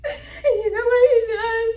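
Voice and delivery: high, loud, wailing sob-talk; tearful complaining wife. Acted. Daughter-in-law complaining about her father-in-law, tearful wail. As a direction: wail out a hammed-up crying complaint.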